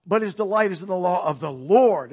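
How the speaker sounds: "Lord" punched loud at the end; stress on "Lord". Shown as a demonstration. Preacher showing another emphasis in the verse, stress on "Lord". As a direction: run through the verse and land hard on "Lord". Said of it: "different emphases on different words or different phrases".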